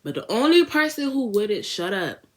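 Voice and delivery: usual speaking voice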